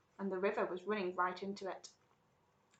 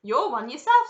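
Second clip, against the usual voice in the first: higher, loud comeback; sharp retort; 6 semitones higher, 15 dB louder than usual. Acted. Wonka answers back to Violet, sharp and loud. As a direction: throw the word straight back.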